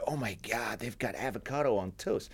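mock an American gushing in a half-hearted American accent; terrible American accent. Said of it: "That's my terrible American accent".

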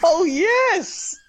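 high, thrilled whoop and laugh; real excited shriek; excited cheer. Real, not acted. Wins favourite food on the spin.

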